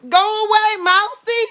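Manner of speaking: high, loud, scared shoo; frightened shooing. Real, not acted. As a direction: squeal at it to leave.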